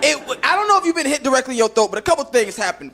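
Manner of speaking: ordinary talk, nothing marked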